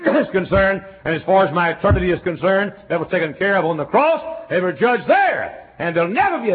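loud, rising preacher's crescendo; full-force preaching. Acted. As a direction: preach it with mounting fire.

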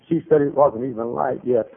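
normal talking voice

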